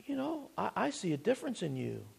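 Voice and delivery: quoted onlooker. Retold or imitated. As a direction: quietly voice the world's surprised remark. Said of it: "the world looks at us and says".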